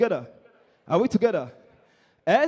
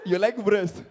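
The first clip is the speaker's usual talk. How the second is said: usual speaking voice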